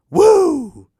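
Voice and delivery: sudden high loud wrestler's woo; Ric Flair woo. Retold or imitated. Podcaster does his one Ric Flair impression, a loud high woo. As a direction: bark out a Ric Flair woo like the real thing. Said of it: "horrible Ric Flair impression".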